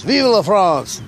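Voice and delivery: put-on loud, high French-accented battle cry. Frenchman's last words, proudly shouted in a French accent. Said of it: "So they go to the Frenchman".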